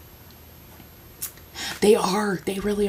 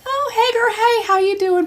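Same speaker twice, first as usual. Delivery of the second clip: much higher, sing-song imitation of the husband; gushing friend greeting. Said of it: "came up and was like".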